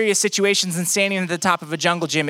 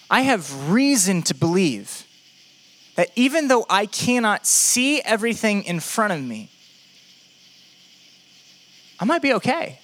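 ordinary talk

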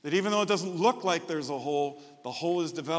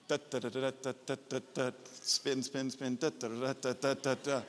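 imitated snare drum; 3 semitones lower, 5 dB quieter than usual.

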